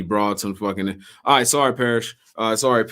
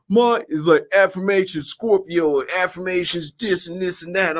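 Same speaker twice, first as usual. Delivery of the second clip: higher, sing-song mock of affirmation posts; high mock influencer voice; mocking Instagram poster; 7 semitones higher than usual. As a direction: parody the girls posting affirmations. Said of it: "I'm just like".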